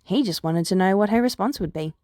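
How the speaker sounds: usual speaking voice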